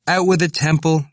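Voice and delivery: high, loud heckle; crying out. A narrator's reading. Stout student heckling Temple from the steps, crying out. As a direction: call it out from a little way off. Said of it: "the stout student cried".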